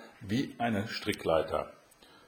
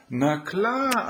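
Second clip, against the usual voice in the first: high, louder, eager answer; Aschenputtel; 12 semitones higher, 5 dB louder than usual. A narrator's reading. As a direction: pitch it well up, bright and sure.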